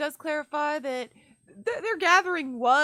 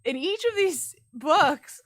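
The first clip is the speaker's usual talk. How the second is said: usual speaking voice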